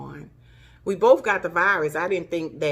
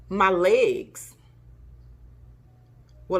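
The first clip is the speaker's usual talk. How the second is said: ordinary talk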